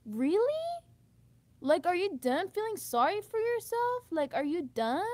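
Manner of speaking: loud, high, exasperated demand; exasperated outburst. Real, not acted. Venting at her mother about self-pity, loud exasperated outburst. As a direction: let the frustration burst out at her.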